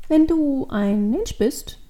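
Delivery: high, slow, drawn-out wording; tentative child. A narrator's reading. Devil girl begins asking the man, slow and tentative. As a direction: start slowly, feeling out the question.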